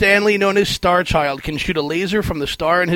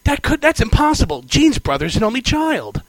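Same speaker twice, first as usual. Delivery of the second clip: mock [name] voice; 7 semitones higher than usual. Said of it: "in that horrible [name] accent".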